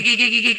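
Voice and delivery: high, laughing klik klik; playful hoofbeat sounds; horse clip-clop imitation. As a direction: click it out like hooves. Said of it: "masih ada klik".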